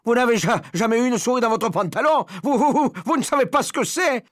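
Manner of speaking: high, loud, stuttering indignation; outraged shouting. A narrator's reading. Cook defending himself to laughing colleagues, shouting. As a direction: splutter and yell at the mockers. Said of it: "criait l'homme".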